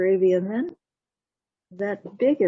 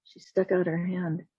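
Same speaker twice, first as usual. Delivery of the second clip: plain reading voice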